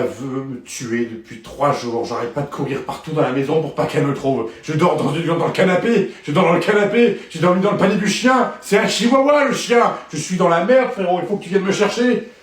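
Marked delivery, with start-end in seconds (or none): 4.6-12.3 loud, frantic pleading into the phone; panicked pleading. Acted. Hunted husband begging Thierry on the phone, frantic. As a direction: beg your friend in a panic.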